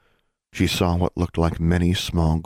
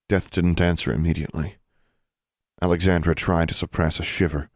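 usual speaking voice

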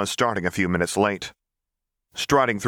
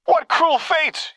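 hammed-up wail, much higher, louder and slow; mock cry; 5 dB louder, much slower than usual. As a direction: wail it like an overacted tragedy. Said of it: "he cried".

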